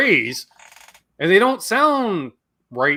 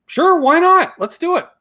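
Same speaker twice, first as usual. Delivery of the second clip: eager; 11 semitones higher, 3 dB louder than usual. Pretend. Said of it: "I'd be, like, literally just".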